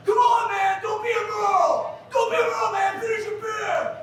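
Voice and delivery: high, strained mock yell; yelling guy. Retelling a stranger at a party yelling at guys, high mock yell. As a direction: shout him down at the party. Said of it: "totally yelling at these guys".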